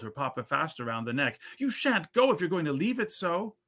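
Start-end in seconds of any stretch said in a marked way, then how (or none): 1.6-3.6 put-on pleading child. A narrator's reading. Child clinging, pleading with papa.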